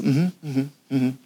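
leise mit geschlossenem Mund brummen; verkniffenes Zuhören. Acted. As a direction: stumm bleiben wollen, nur ein kleines, tieferes Mhm. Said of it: "du hältst deinen Mund". (leises, verkniffenes Brummen)

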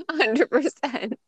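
high laugh through the words. Real, not acted. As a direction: giggle it out slowly. Laughing agreement.

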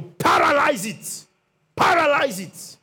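high, slow shouted command; preaching shout. Acted. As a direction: hammer the word paralyze.

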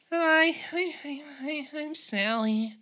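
squeaky shy Sally stammering her name; nervous waitress. Acted.

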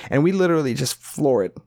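ordinary delivery

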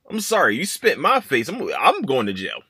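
ordinary talk

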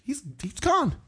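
ordinary talk, nothing marked

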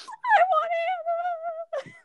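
high, loud wail, imitating crying; mock bawling. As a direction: wail it like a sobbing little kid, hammed up. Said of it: "start bawling my eyes out".

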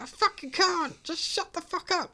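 high, loud mock outburst; acted-out exasperated shouting; retold angry yell. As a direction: scream back as the trapped character. Retelling stuck character yelling back.

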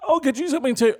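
lightly mimicked girlfriend, pitched high; girlfriend's reply. Girlfriend promising boyfriend a gift back, lightly mimicked. Girlfriend's answer, acted higher.

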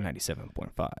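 low mock station ident; put-on radio announcer voice.

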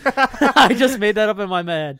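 loud, laughing admission; words tumbling into real laughter; laughing confession. Real, not acted. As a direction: break up laughing while owning up. Admits making it up, laughing.

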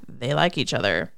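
low-pitched imitation of his inner aside; jealous thought. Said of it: "and he's thinking".

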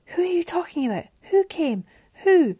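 high-pitched, urgent demand to know; frantic questioning. A narrator's reading. Mariana demands the killer's name, frantic and high. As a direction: fire out questions, rising to a loud last cry.

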